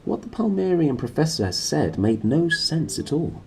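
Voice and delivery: ordinary delivery, nothing marked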